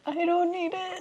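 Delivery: high, laughing squeal. Real, not acted. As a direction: giggle through the words. Laughing banter about water.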